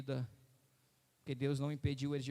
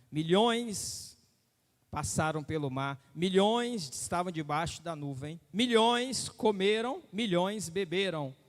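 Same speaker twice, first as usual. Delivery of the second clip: rising preaching list on milhões; emphatic sermon listing; 3 semitones higher, 5 dB louder than usual. Acted. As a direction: stack each milhões higher. Preaching build.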